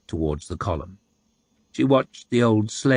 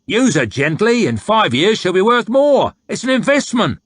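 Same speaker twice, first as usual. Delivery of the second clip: loud, higher coarse soldier voice, pushing a sale; crude haggling soldier; 6 semitones higher, 4 dB louder than usual. A narrator's reading. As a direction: wheedle and bully the buyer at once.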